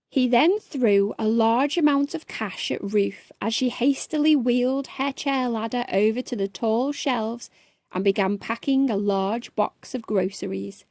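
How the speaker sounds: usual speaking voice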